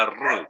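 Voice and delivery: "r" as a strong Spanish trill; Spanish rolled r. Shown as a demonstration. Teacher contrasts the Spanish r for students, trilled. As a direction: roll the "r" hard like Spanish. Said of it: "en español o en castellano suena".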